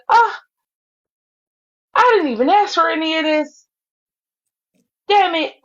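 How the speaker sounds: high-pitched mock exasperation; imitated put-upon lament. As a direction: act out a hapless host's complaint. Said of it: "poor Georgia Peach, she was just like".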